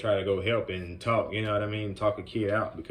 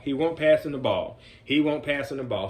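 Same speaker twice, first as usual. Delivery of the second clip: ordinary talk, nothing marked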